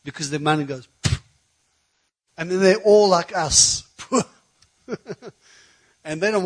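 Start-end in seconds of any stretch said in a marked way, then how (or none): 1.0-1.2 quick puff through the lips; dismissive raspberry puff. Retold or imitated. Preacher on money vanishing, puffed-out sound effect.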